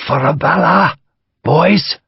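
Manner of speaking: high, urgent Irish rallying call; Irish major urging his men forward in an Irish accent; Irish battle cry, urged. Acted. Major urging men on, urgent Irish call. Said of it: "appeared to say softly and urgently".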